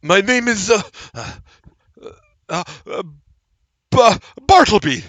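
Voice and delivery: flustered halfling tripping over words; stammering halfling. Acted. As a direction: stammer and stall through a made-up identity.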